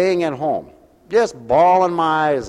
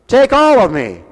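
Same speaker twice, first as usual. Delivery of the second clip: spoken, not sung, higher and louder; preached shout; 7 semitones higher, 7 dB louder than usual. Real, not acted. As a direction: throw the hymn line at the crowd as a loud shout. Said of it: "You should be singing to the Lord".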